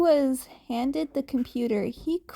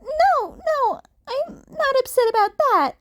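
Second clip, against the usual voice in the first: much higher, louder, quick denial; protesting kid Deku; 13 semitones higher, 6 dB louder than usual. A narrator's reading. Cat kid denying he's upset, high and loud. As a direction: wave it off in a hurry.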